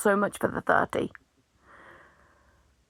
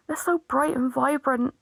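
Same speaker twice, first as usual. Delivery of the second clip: ordinary talk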